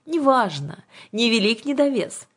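high, impatient witch; brisk, high-pitched dismissal; cutting her off. A narrator's reading. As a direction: interrupt and brush the detail aside. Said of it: "оборвала её Ага".

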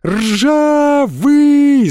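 high, long-drawn shout; frantic yell. A narrator's reading. Boy searching for vanished friend, frantic yell. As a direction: yell the name with all your might. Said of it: "заорал я не своим голосом".

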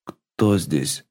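low, soft, hoarse croak. A narrator's reading. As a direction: squeeze it out hoarsely. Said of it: "выдавил из себя осипшим голосом".

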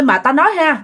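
plain reading voice

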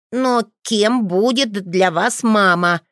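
put-on a boy refusing to drop it; stubborn child's question; insistent. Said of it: "Лео настаивал на своём".

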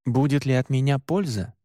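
ordinary delivery, nothing marked

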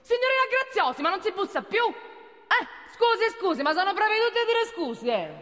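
scolding. Acted. Boss scolds barging secretary.

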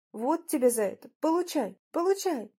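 high, scolding exclamations; angry pummelling cries. A narrator's reading. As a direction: cry out with each blow, pitch climbing. Wife beating the captive. (злые выкрики высоким голосом)

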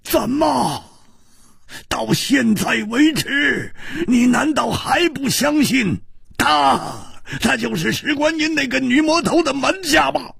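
high, sustained outraged yelling; incredulous, full-throated rage; furious roar. A narrator's reading. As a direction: roar the accusation, holding each phrase high and long. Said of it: "怒吼道".

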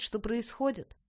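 usual speaking voice

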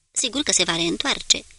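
ordinary delivery, nothing marked

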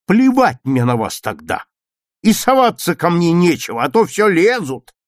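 loud, defiant dismissal; angry brush-off. A narrator's reading. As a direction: tell them off, rising in pitch. Petya angrily breaks with his classmates. (злая отповедь, громко)

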